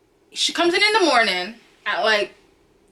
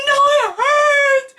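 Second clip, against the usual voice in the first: high, slow wailing cry; bawling; 6 dB louder, much slower than usual. Retold or imitated. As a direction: wail it out through tears.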